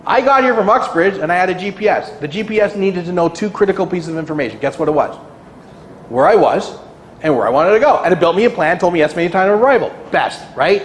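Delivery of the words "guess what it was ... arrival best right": usual speaking voice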